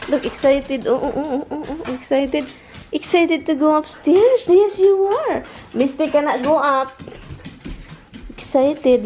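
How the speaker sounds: high, sing-song cooing at the dog with a little laugh. Real, not acted. Owner coaxing her excited dog upstairs, in sing-song baby talk. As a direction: coo at her like a puppy.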